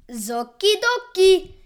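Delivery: high, loud Italian waiter voice; chirpy Italian accent. A narrator's reading. Waiter taking the order to the kitchen, chirpy Italian accent.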